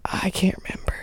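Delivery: hushed, breathy whisper. Real, not acted. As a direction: whisper it, a little lost. A whispered, forgetful reply.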